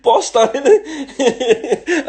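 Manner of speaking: high, loud laughing delight. Real, not acted. Telling viewers about Uber tracking, laughing with delight. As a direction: laugh through it, thrilled with the discovery.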